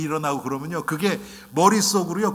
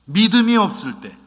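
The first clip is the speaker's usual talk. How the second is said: louder and higher, the repeated 믿음이 없을 때 hammered home; emphatic repeat; 6 semitones higher, 8 dB louder than usual. Shown as a demonstration. Preacher repeats the key phrase to the congregation, loud and emphatic. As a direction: repeat it with full force. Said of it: "딱 하나".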